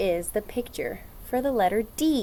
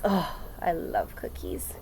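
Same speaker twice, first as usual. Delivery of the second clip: dreamy; 5 semitones lower, 6 dB quieter, slower than usual. Acted. Cookie love after reveal.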